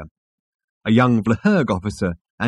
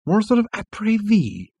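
higher, swooping pitch; Arthur's voice; 10 semitones higher than usual. A narrator's reading. Arthur correcting himself in the afterlife bar, higher character voice. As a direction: lift it well above the narration.

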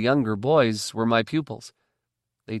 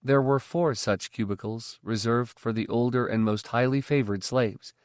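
plain reading voice

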